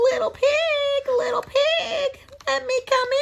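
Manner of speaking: high, sing-song calling. A narrator's reading. Coyote calling to the pigs, high and coaxing. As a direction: call sweetly up to the window. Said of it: "he called".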